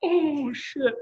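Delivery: embarrassed oh shit. Retold or imitated. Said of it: "I'm like".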